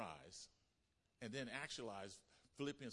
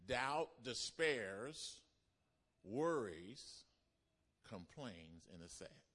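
sinking into sadness; 2 semitones lower, much slower than usual. Acted. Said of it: "I'll say it again".

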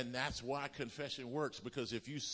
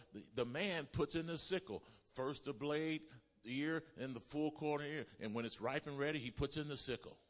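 ordinary delivery

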